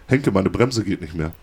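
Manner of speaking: soft, slow, deadpan; quiet deadpan. Retold or imitated. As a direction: say it low and matter-of-fact. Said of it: "Auf einmal sagt er zu mir".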